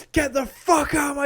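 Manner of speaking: loud, high mock yell; retold scream. Sensei throws challenger out of the dojo, mock screaming. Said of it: "screaming at him".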